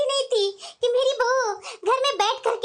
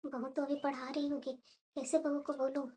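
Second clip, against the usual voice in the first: much softer, slow worried murmur to herself; worried aside; 6 semitones lower, 15 dB quieter than usual. Acted. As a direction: keep it under your breath so he won't hear. Worried aside, hiding from husband. (धीमी घबराई आवाज़ में)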